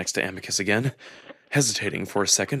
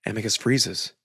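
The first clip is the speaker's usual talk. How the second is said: ordinary delivery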